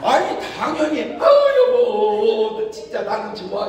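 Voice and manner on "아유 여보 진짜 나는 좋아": high, loud sweet gush; gushing wife. Retold or imitated. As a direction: coo at the husband like a delighted wife. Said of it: "이리 하잖아". (남편에게 반색하는 아내, 높고 크게 들뜬 목소리)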